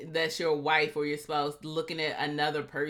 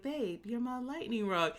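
mock sweet talk; 6 semitones higher, 6 dB quieter than usual.

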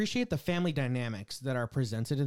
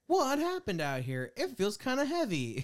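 mocking character voice; 8 semitones higher than usual. Mock-voicing characters.

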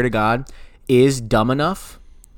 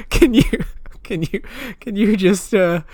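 laughing; 8 semitones higher, 5 dB louder than usual. Real, not acted. Laughing while asking for help.

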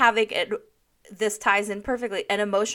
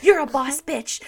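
louder, higher mock hype-up; hammed-up bar-bathroom cheerleading, pitched up and loud; drunk girl pep talk; 6 semitones higher, 5 dB louder than usual. Host mimicking her own pep talk in her kitchen, loud mock hype. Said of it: "I'm giving this drunk girl bath-, bar bathroom talk".

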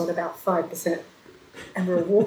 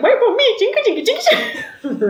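much higher, louder, laughing as you run; laughing call out; 6 dB louder, faster than usual. Retold or imitated. Bride running after the others to the cab, high laughing call. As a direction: call after them while jingling along.

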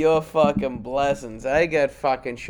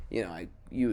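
usual speaking voice

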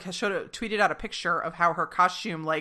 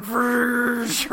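imitated whoosh of a wind machine; wind machine noise; 4 semitones higher, 3 dB louder than usual. Imitating Mikey's wind machine, whooshing. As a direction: blow out a mock wind gust. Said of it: "it's just like".

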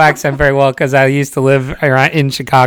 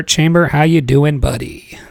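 ordinary delivery

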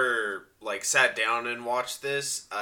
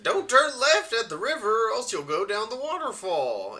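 put-on old prospector; 11 semitones higher than usual. Prospector bit, waterfall warning.

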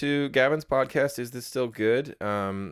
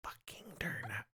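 whisper; 15 dB quieter than usual. Pretend. Whispered dare game.

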